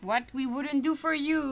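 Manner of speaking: usual speaking voice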